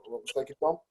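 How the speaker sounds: ordinary delivery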